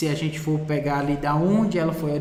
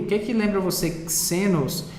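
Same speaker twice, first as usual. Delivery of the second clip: normal talking voice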